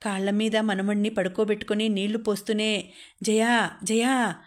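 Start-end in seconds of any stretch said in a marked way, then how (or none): 3.2-4.4 drawn-out, slow call of a name; a mild narrated call, stretching each name. A narrator's reading. Mother-in-law summons her daughter-in-law while bathing the baby, calling out. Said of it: "అంటూ గట్టిగా పిలిచింది".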